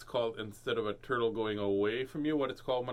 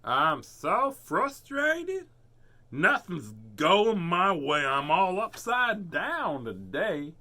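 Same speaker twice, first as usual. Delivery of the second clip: very slow, high, loud turtle groan; slow high fed-up turtle; frustrated turtle; 4 semitones higher, 5 dB louder, much slower than usual. Acted. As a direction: drag it out slowly like a fed-up turtle. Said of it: "Turtle did not look happy".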